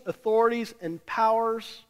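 usual speaking voice

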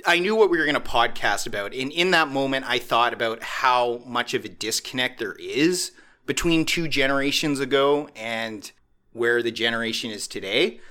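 ordinary talk, nothing marked

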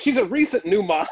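high, laughing disbelief; half-laugh the line; giggling retelling; laughing quote. Retold or imitated. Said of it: "he's like".

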